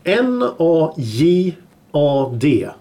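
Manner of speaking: very slow, letter by letter; slow spelling. Shown as a demonstration. Host spells the word for co-hosts to listen, slowly. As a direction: spell it out with gaps between letters.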